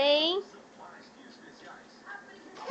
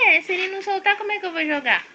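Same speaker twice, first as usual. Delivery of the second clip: ordinary talk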